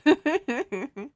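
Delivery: Ooh, woo up in a squeaky head voice with a laugh; high feminine voice. Shown as a demonstration. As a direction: show off a high girly voice. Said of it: "I have a feminine voice".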